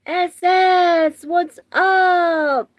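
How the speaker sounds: loud, high holler; shouted hello. Real, not acted. Streamer spots a viewer in chat, shouted hello. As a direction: call it out big and high.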